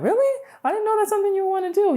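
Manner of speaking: high, fast, surprised squeal; surprised friend. Retold or imitated. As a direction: jump way up in pitch, like you can't believe it.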